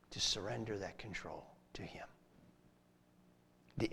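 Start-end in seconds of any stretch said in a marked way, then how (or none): 0.1-2.1 breathy hush, almost no voice; soft whispered plea; whispered surrender. Real, not acted. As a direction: let it fall to a whisper. Sermon's hushed climax.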